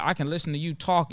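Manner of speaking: ordinary delivery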